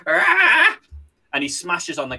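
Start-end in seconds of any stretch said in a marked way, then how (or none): 0.1-0.8 sudden high angry cry; high yelp of rage; angry yell. Acted. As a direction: let out a furious little shout. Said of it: "he gets angry and he goes".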